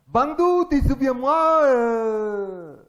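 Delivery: put-on loud, high caricature Chinese accent; hammed-up Chinese accent with a long drawn-out ending; mock Chinese accent. Coach greeting his student, mock Chinese accent. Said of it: "c'est un accent chinois que je vous fais".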